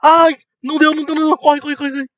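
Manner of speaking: high panicked yelling; being chased. Real, not acted. Panic while chased in game.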